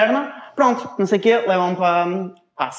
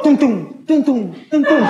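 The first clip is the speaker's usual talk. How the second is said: stomping sound effect; 5 semitones higher, 3 dB louder, slower than usual. Retold or imitated. Mimicking heavy stomping steps.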